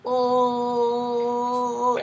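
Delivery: "L" slowly held as lll; l sound. Shown as a demonstration. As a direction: stretch "L" into a long lll. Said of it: "you need to show them L".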